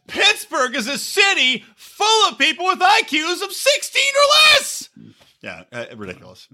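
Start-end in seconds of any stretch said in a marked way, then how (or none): 0.1-4.9 very high hammed-up shouting; high-pitched yelled proclamation, climbing at the end; mock screaming. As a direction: build to a shrieked parody insult. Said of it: "then he started screaming at him".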